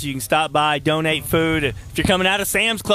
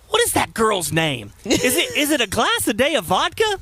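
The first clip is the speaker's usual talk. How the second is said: baffled question climbing much higher; puzzled listener; 7 semitones higher than usual. Retold or imitated. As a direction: ask as if you misheard the name.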